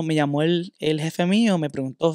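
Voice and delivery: ordinary talk, nothing marked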